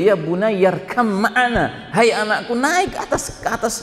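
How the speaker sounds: high, pleading call to a drowning son. Acted. Noah calling his drowning son to board the ark, high and pleading. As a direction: call out high across the water. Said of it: "Dia panggil".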